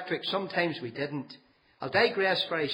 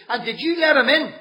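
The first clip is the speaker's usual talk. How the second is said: much higher and louder, outraged; furious shout; 11 semitones higher, 9 dB louder than usual. Retold or imitated. Father shouting at his son for letting the man in. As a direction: yell it at the boy in disbelief. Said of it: "he shouted at me".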